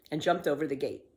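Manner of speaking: plain reading voice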